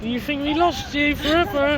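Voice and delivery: high cooing pet talk. Real, not acted. Talking to dog.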